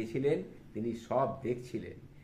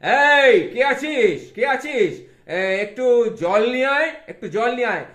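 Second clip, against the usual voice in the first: loud higher shout to the servants; calling out; 13 semitones higher, 15 dB louder than usual. Acted. Vidyasagar shouting to his servants for water, loud. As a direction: shout for a servant across the house.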